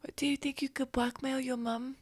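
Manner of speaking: soft, slightly high character voice; hushed scheming. Acted. Adventurer proposing blackmail to the child, hushed. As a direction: suggest it under your breath.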